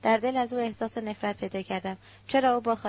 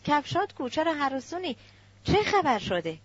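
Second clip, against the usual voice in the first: higher, puzzled volley of questions; bewildered questioning; 5 semitones higher than usual. A narrator's reading. Uncle asks the fleeing girl what happened, bewildered and pressing. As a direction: demand answers in alarmed surprise. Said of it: "دایی حیران مرا نگریست".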